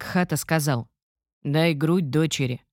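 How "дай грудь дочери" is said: put-on slow old man's voice. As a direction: speak slowly like an elderly sage. Old man at river speaks.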